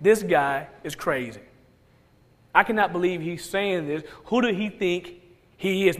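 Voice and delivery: low, softened grumble among offended listeners; mild grumbling. Acted. Offended crowd grumbling to one another about Jesus, muted grumble. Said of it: "They're grumbling to one another saying".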